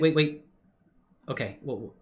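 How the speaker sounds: ordinary delivery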